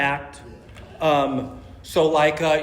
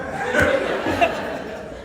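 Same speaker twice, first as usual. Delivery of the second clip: laugh. Real, not acted. Laughing at his own joke.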